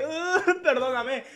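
imitating viewers' exaggerated apology; mock apologetic viewer. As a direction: act out a pleading sorry. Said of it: "es como que".

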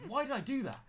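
high, loud regretful cry; panicked wail. Real, not acted. Spider chase again.

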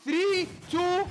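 game-winning play-by-play eruption; ecstatic caster scream. Real, not acted. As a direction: lose it over the final knockout.

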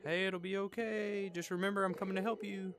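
high-pitched calling-out reassurance; reassuring call. Acted. Player to a crying character, high-pitched reassurance.

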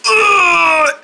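high demonstrated effort grunt; lifting grunt. Shown as a demonstration. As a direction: grunt as if lifting something heavy. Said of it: "You gotta go like".

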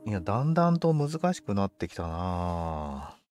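ordinary talk, nothing marked

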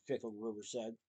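ordinary delivery, nothing marked

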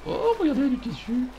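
mock delight. Mock discovery in game.

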